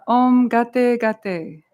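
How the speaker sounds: slow, drawn-out phrase for repeating; call-and-response model. Shown as a demonstration. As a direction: lead the group slowly, leaving room to echo. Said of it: "repeat after me".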